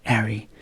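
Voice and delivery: "Harry" begun straight on the vowel, H silent; dropped H. Shown as a demonstration. Teacher repeating the London example, "Harry" with dropped H. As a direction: drop the H from "Harry". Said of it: "dropped the H sound".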